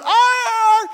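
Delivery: loud, very high imitated yowl; frightened dog howl. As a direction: howl like a dog scared stiff. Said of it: "it scared her so much".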